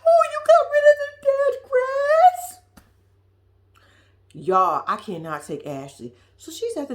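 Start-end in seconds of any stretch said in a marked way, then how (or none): 0.0-2.6 high, slow hammed-up blubbering; mock happy crying. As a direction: overact Ashley crying with joy over the grass. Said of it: "Ashley gonna cry".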